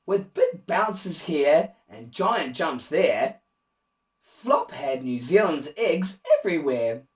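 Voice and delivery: usual speaking voice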